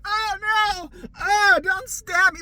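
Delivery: high, loud, slow hammed-up plea; hammy fake victim crying not to be stabbed; terrible acting. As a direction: beg like the worst actor alive. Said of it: "the worst acting on the planet".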